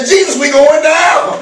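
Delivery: high, slow, shouted cry for help; panicked plea. Pretend. Believer cries to Jesus in a storm, shouted panic. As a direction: holler to Jesus from the sinking ship. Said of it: "I can just say".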